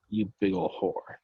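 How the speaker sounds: breathless whispered tease; mock whisper insult. Teasing his friend with a whispered playful jab. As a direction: hiss the insult like a joke.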